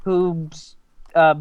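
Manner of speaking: usual speaking voice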